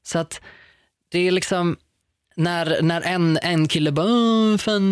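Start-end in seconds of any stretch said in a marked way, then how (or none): 4.1-4.9 high, loud, drawn-out jeer; mocking boy imitation. Imitating a guy saying something mean, mocking. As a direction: mimic a bully's wordless taunting.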